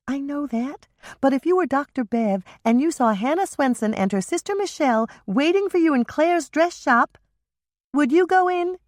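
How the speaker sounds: bright teasing younger sister; younger sister Michelle. A narrator's reading. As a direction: younger sister joking about a fight. Michelle voiced higher.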